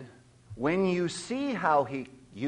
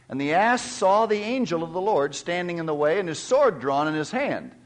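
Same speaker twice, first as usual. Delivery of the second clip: louder, raised solemn Bible reading; scripture reading; 4 semitones higher, 5 dB louder than usual. Acted. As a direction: proclaim the passage to the congregation. Reads scripture aloud.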